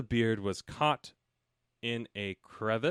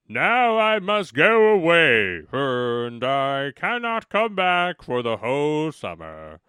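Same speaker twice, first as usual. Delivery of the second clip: bear's voice; 4 semitones higher, 11 dB louder, slower than usual. A narrator's reading. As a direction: boom it out slowly as a big friendly bear.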